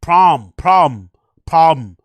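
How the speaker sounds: "Prom" said three times, loud and clipped; ridiculing the chopped "problem"; mocking "prom". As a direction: hammer out "prom, prom, prom" like a lazy "problem". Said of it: "He's lazy and doesn't want to finish words".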